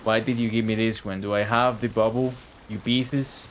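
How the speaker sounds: ordinary talk, nothing marked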